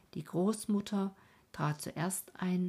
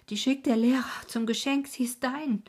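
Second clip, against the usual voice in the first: excited; 7 semitones higher, 7 dB louder than usual. Acted. Grandmother hands over violin gift.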